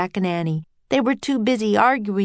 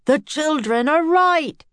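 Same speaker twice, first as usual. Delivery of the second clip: slow, higher declaration; shouting; 8 semitones higher, 3 dB louder than usual. A narrator's reading. Professor siding with the children, shouting. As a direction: proclaim it with drawn-out emphasis. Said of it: "shouted the professor".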